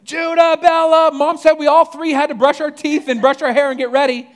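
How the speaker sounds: high, loud yell calling down the hallway to siblings; child's scream. Retold or imitated. Little sister yelling down the hallway to her siblings, loud high scream. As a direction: shriek it like a kid tattling to the whole house. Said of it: "Lindley immediately screams down the hallway".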